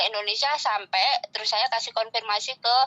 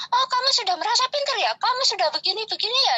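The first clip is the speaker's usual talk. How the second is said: high, sneering mock outrage; high-pitched mocking tirade; mocking angry rant; 11 semitones higher than usual. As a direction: mimic her scornful scolding. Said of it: "dia marah besar lah".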